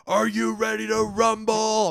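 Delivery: loud, high, stretched-out announcer call; taped ring announcer. Retold or imitated. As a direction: bellow it like a fight announcer. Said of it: "this thing comes on".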